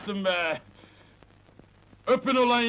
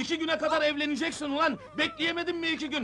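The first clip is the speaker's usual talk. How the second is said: fast, higher-pitched telling-off; exasperated scolding; 5 semitones higher, 4 dB quieter, faster than usual. Acted. Brother berating the groom before the wedding, exasperated scolding. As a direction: scold him in a heated rush.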